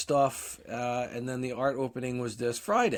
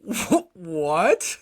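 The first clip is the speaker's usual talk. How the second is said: stunned disbelief; 4 semitones higher, 5 dB louder than usual. Retold or imitated. Shock at hundred percent.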